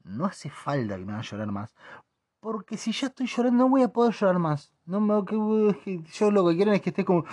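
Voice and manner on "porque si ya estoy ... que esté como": higher, whining; exasperated. Real, not acted. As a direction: complain pitched up. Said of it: "ya está llorando".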